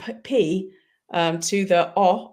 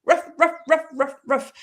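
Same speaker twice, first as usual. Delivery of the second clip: clipped high yaps, ruff ruff; playful mock dog bark; 7 semitones higher than usual. Shown as a demonstration. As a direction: bark it out like a small dog, each ruff its own pulse. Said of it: "sounds almost like a dog barking".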